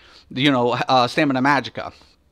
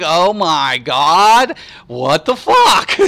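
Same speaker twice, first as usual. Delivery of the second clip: incredulous laughing; 3 semitones higher, 4 dB louder, much slower than usual. Retold or imitated. Streamer to viewers, recalling the tubers' advice, laughing disbelief. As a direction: drag it out, laughing at the absurdity. His own reaction to the tubers.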